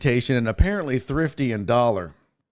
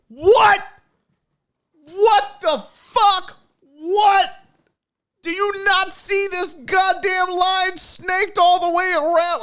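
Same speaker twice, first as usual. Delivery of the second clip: much higher, louder mock shouting; outraged line; 5 dB louder, slower than usual. Imitating the furious rental line, mock shouting. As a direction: yell as the furious crowd. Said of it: "the whole line just loses their shit".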